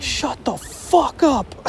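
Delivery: slow, high mocking impression of the driver; mock snarl. Driver telling the crying friend to shut up, slow mocking snarl. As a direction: sneer it out slowly. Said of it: "the person driving was like".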